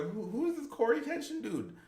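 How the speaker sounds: puzzled surprise. Retold or imitated. Retells his puzzled reaction.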